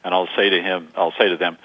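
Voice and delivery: ordinary talk, nothing marked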